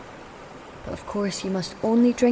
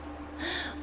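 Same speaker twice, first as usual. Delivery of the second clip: quiet mocking laugh; 10 semitones higher, 12 dB quieter than usual. Witch laughs at trapped king.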